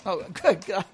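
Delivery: usual speaking voice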